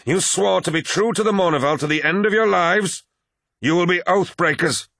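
high, impassioned accusation; crying out. A narrator's reading. Abaddon reminds brothers of their oath, crying out. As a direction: hurl the charge after them, pitch way up. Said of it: "cried Abaddon".

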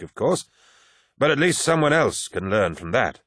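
put-on garrulous old warrior holding forth; old veteran Cruz. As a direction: lecture the young man like a proud old-timer. Narrator voices old Cruz.

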